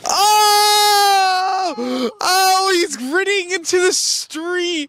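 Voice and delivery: high, squealing mock cry; dramatic wail. Real, not acted. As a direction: wail like it hurt. Friend leaves grinning after joke.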